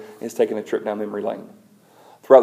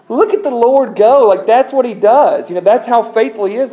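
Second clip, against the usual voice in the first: exultant praise; 11 semitones higher, 7 dB louder than usual. Real, not acted. Preacher exulting over God's faithfulness.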